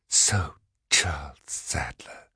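slow, low venomous whisper; snake-like hiss. A narrator's reading. Exposed woman repeats her accuser's name, hissing. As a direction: hiss it slowly, all breath. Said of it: "like a snake's hiss".

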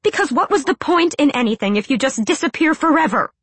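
high, loud hissed plea; hissing. A narrator's reading. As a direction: spit it out desperately. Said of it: "she hissed".

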